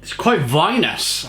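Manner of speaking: ordinary talk, nothing marked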